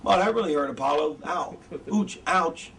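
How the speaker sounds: deadpan, badly faked hurt; mock an actor who won't sell the punches; unconvincing pain. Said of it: "he's like".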